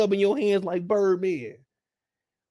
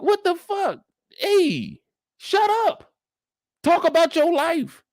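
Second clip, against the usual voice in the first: much higher, exasperated shout; telling off; 10 semitones higher, slower than usual. Acted. Fed-up viewer snapping at a nosy guest, much higher. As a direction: cut her off hard.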